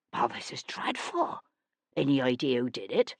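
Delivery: lightly whispered dismay; hushed dismay. Acted. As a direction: play at being appalled. Feigned dismay.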